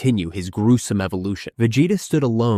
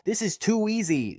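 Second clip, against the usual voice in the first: high, scornful sneer; 12 semitones higher, 3 dB quieter than usual. Acted. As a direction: sneer down at the beaten foe. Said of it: "Zarbon sneered".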